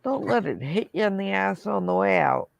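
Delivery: ordinary delivery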